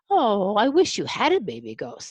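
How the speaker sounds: plain reading voice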